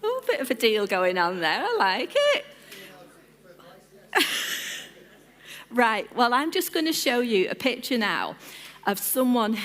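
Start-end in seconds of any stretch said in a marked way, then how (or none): 4.1-4.8 voiceless burst of laughter; short wheezy chuckle; amused laugh. Real, not acted. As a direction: laugh with hardly any voice. Amused by an audience answer.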